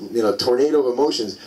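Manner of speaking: ordinary delivery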